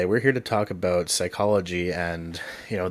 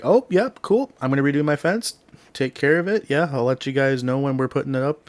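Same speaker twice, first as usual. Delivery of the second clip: high, cheery Canadian voice; breezy Canadian neighbor talk; Canadian dude impression; 6 semitones higher, 3 dB louder than usual. Neighbor promising to redo the fence, high Canadian accent. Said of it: "good, good old Canadian guy".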